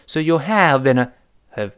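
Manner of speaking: ordinary talk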